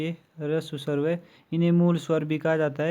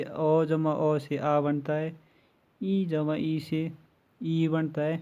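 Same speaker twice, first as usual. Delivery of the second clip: slow worked example; much slower than usual. Shown as a demonstration. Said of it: "इसका example discuss करते हैं".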